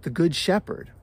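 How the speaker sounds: ordinary delivery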